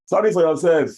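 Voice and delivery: high-pitched indignant comeback; mock outrage at being apologised to; acted angry retort. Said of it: "I'm getting very, very angry".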